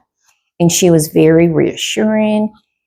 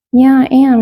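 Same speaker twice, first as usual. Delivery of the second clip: a raised, higher-pitched "Yeah, I am"; insistent retort; 7 semitones higher, 5 dB louder than usual. Retold or imitated. Retelling to the fraud caller, insistently raised voice. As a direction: push back hard, sure of yourself.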